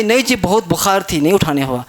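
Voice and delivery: low, subdued retold reply; weakened man's excuse. Preacher quoting a man laid low by fever, low subdued voice. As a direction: drop well down in pitch for the excuse.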